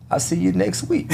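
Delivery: chuckling, soft cheeky reply; laughing. Retold or imitated. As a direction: laugh through the comeback. Cheeky reply to rejecting woman.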